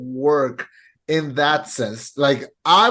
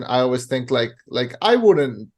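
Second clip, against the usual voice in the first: ordinary talk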